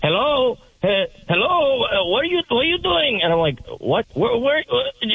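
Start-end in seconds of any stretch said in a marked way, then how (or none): 0.0-3.2 high-pitched, hammered caller impression; imitating drunk caller. Drunk scammer answering a prank-minded teen, imitated as slurry drunk. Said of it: "he sounds drunk". 3.8-5.1 high, stumbling mock stutter; imitated stuttering. Drunk caller confused by the teen, imitated stutter. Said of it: "he's stuttering".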